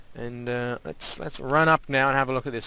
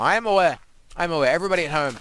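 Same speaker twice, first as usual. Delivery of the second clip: higher, louder self-aware outburst; emphatic admission; 4 semitones higher, 6 dB louder, much faster than usual. Real, not acted. Player admits to viewers he is being cringe, raised voice.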